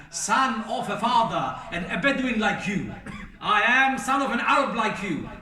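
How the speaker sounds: loud, rising, fervent sermon; preacher hammering the point home, loud; impassioned preaching. Real, not acted. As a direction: drive it home with a laugh in the voice. Preacher's rousing climax.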